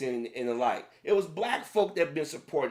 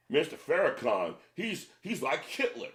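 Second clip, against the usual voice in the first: mock critic. Mocking critics of Farrakhan.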